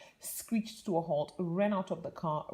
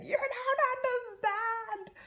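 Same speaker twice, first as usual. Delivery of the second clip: panicky plea; slower than usual. Retold or imitated. Retold panic to air hostesses.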